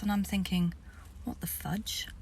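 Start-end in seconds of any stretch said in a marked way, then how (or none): 1.2-2.1 breathy whispered aside; whispered thought. Retold or imitated. Her inner reaction as the chief bows, whispered. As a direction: whisper it to yourself, stunned.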